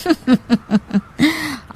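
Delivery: a soft, falling villain's chuckle; evil chuckle. Acted. Uncle plotting a fight, with a soft villain's chuckle. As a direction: laugh quietly at your own plan. Uncle about to spoil the food.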